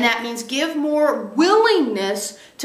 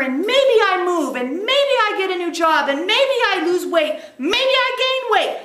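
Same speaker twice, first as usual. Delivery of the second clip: fired-up refusal; 7 semitones higher, faster than usual. Retold or imitated. Speaker voicing their own fed-up vow to quit old habits, high, fast and emphatic. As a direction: declare it like you're finally free of it, faster and higher as you go. Acting out firm refusal to self.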